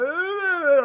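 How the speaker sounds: a sudden high cackle of laughter; high laughing burst. Real, not acted. Laughing while retelling how she cried at the ending.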